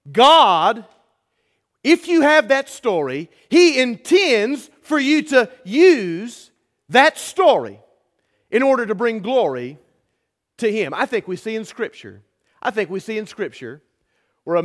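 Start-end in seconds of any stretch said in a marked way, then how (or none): 0.1-10.9 rising, louder preacher's push; preaching at full force. Real, not acted. Pastor to his church, preaching at full force. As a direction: build it up like a pulpit sermon.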